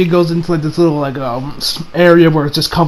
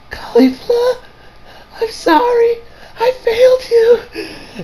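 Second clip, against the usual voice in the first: much higher, tearful, slow whimpering voice; crying apology; 4 dB quieter, slower than usual. Retold or imitated. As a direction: sob it out like a beaten girl letting her friend down. Beaten Kale apologizes to Caulifla.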